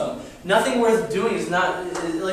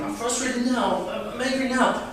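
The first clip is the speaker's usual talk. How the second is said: put-on whiny frustrated kid; 4 semitones higher than usual.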